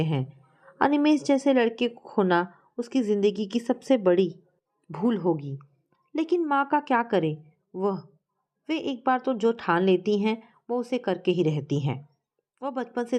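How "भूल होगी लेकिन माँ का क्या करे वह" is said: ordinary delivery, nothing marked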